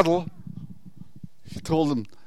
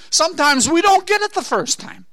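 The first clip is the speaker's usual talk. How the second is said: high, loud rising exhortation; fervent preaching; 9 semitones higher, 5 dB louder than usual. Real, not acted. Preacher urging sleepy congregation, fervent high loud cry. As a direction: cry out to wake the church.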